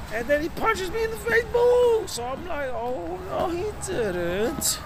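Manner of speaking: squealed high, mock panic. Narrator squealing as he gets punched, high mock panic. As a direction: squeak it out like a cartoon.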